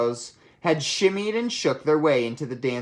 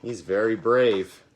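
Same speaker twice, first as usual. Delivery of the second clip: usual speaking voice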